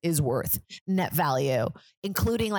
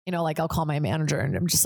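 usual speaking voice

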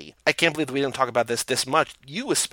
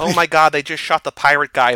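mock shock; 2 semitones higher, 9 dB louder than usual. Acting out shocked viewer of film.

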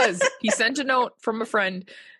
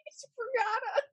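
high and breathless with laughter; giggling, barely getting words out; cracking up mid-line; 13 semitones higher, 7 dB quieter than usual. Real, not acted. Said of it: "You're the funniest person".

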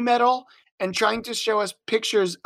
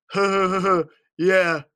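dopey laugh. Retold or imitated. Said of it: "he thinks it's the funniest thing ever".

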